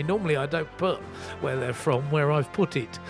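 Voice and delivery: ordinary talk, nothing marked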